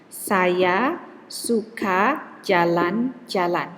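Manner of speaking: each syllable given the same length, slowly; "Saya suka jalan-jalan" said slowly, every syllable equally long; even syllable timing. Shown as a demonstration. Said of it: "For example, I have a sentence".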